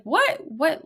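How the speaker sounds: high-pitched mock double take; startled disbelief. Girl in her kitchen sees a kid, startled disbelief. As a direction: squeak it in surprise. Retold girl spots a child.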